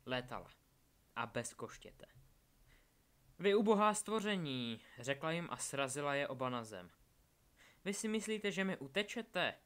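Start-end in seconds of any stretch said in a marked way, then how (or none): 3.4-4.8 high, loud contemptuous outburst; villainous scorn. A narrator's reading. Villain taunts boys she strikes down. 7.8-9.5 high-pitched villainous challenge; scornful taunt. A narrator's reading. Villain mocks boys' attempt to flee.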